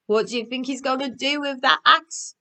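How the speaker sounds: usual speaking voice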